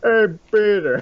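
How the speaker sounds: high, loud hearty greeting; Joe Swanson. Retold or imitated. Joe Swanson greeting Peter, high and loud. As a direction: call out to Peter like a cheery cop. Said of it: "That's the only thing I can do".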